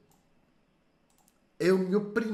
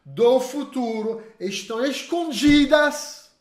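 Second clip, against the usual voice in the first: higher and louder, slowed for emphasis; emphatic; 6 semitones higher, 5 dB louder than usual. Shown as a demonstration. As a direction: drive the point home. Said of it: "presta atenção". (ênfase forte e mais lenta)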